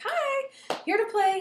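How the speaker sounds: put-on high child's hello; forced cheery greeting. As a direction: chirp it at the friend's door. Limping child greets friend.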